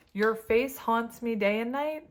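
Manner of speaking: ordinary talk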